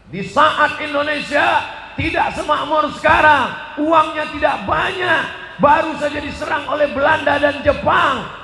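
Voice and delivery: high and loud sermon shout; fiery preaching. Acted. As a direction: preach it with full fervour. (ceramah berapi-api)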